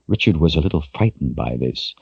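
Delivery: usual speaking voice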